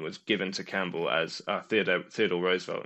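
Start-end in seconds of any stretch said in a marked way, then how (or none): none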